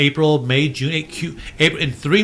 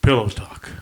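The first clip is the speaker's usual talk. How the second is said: sexy voice; 4 semitones lower than usual. Pretend. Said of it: "I wanna hear that in stereo".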